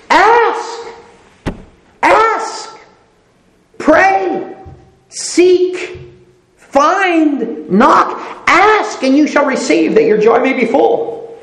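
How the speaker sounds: high, ringing call to ask and pray; fervent preaching. Acted. Preacher urging the congregation to ask, ringing and fervent. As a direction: urge the congregation on, each word a command.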